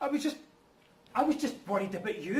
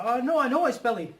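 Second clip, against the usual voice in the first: flustered; 5 semitones higher, 9 dB louder than usual. Acted. Flustered on being caught.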